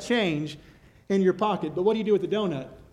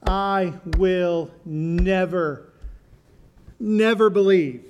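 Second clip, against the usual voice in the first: slow, heavy I will never, never believe; stress on never; much slower than usual. Acted. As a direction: lean hard on each never, drawn out. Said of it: "That word "never" is a strong word".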